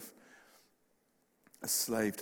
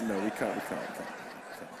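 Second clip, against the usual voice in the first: laughing refusal; 3 semitones lower than usual. Retold or imitated. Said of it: "they just".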